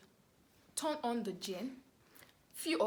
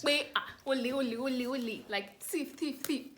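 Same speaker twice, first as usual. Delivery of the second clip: mock thief cry; 3 semitones higher, 4 dB louder than usual. Mock Yoruba movie thief cry.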